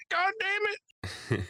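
high-pitched, hammed-up tearful cursing; mock tearful. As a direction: curse as if on the verge of tears. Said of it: "on the verge of tears".

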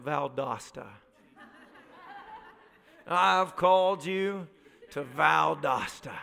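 slow, low solemn pronouncement; God's voice. Acted. God calling someone to a town, in a slow solemn voice. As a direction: intone it slowly as a divine calling. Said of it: "that God's gonna tell him".

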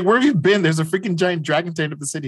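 mock outrage, rushing the words; exasperated call. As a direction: playfully scold a slacker. Scolding the absent teammate.

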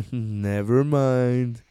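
very slow, stretched out after a chuckle; drawn-out backtrack. Real, not acted. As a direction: stretch each word, amused at being caught. Repeats it drawn out, amused.

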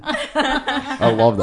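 giggling through the words; delighted laugh in the voice. Real, not acted. Friend begging for impression, laughing.

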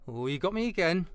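ordinary talk, nothing marked

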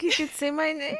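amazed mock squeal. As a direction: squeal it in acted disbelief. Acting out the character's amazed reaction.

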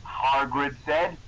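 usual speaking voice

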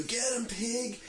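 high mock yell at passing cops; yelling pig at the cop car, mock shout; retold yell. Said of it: "the guy who yelled pigs at him".

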